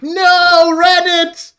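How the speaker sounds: high loud fake horror at "Reddit"; mock outraged cry. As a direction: parody of an outraged viewer. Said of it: "before someone goes like".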